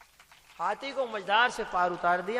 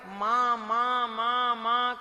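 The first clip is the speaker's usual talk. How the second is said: put-on child calling; 2 semitones higher, 5 dB louder, much slower than usual. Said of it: "बच्चा माँ को बुला रहा है".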